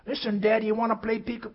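put-on high loud little-boy voice; high boy voice begging daddy; pleading child. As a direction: call out to daddy like a small boy. Boy asks father.

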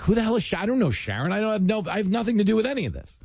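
high-pitched comic indignation; baffled protest. Retold or imitated. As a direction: plead innocence in a high mock-panicked voice. Retells his own baffled protest.

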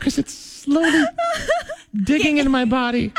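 put-on high, laughing pageant girl complaint; giggly beauty queen, squirming; man doing a woman's voice. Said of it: "sound like a female actress".

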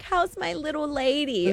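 mock creepy charm. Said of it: "He's like".

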